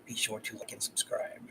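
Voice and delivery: a secret little whisper to the viewers; whispered aside. Real, not acted. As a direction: slip the plug in under your breath. Aside to viewers mid-stream.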